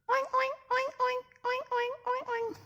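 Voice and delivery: high squealy oinks, far above her talk; a piggy oinking for the kids. Pretend. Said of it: "is the pig".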